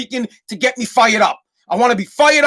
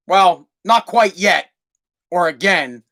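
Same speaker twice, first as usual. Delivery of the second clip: ordinary delivery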